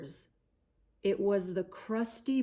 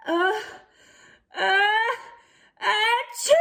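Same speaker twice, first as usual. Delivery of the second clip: building uh, uh into a high achoo; sneeze; 9 semitones higher than usual. Acted. The giant nose sneezes as storyteller acts it. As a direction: wind up and burst into a sneeze.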